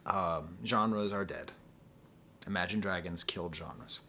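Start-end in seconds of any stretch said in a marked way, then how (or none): none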